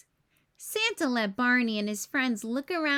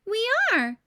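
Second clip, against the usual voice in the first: laughing; 9 semitones higher, 6 dB louder than usual. A narrator's reading. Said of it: "laughed Barney".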